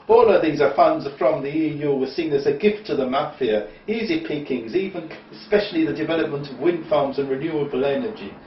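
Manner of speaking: loud, hammed-up Italian accent read aloud; bad Italian accent. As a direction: ham up a mafia informant in a bad Italian accent. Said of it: "I don't do an Italian accent".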